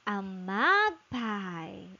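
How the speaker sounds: slow model word. Shown as a demonstration.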